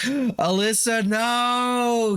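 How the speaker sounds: a high, drawn-out gasp of dismay; shocked gasp. Real, not acted. Reacting to a game character's fate with shock. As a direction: cry out as if a friend just died.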